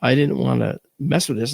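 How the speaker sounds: usual speaking voice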